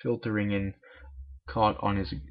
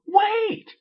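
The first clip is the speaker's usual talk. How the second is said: very high, loud cartoon yell; high-pitched pony shouting after her friend; shrill shout; 7 dB louder than usual. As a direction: stop her with a sharp cry. Pinkie shouts wait.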